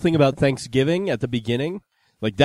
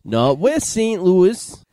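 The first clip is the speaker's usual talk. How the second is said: slow, higher fake Saint Louis accent; mock Saint Louis accent; 5 semitones higher, much slower than usual. Co-host correcting the bit, drawn-out mock Saint Louis accent. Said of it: "This is how we talk in Saint Louis".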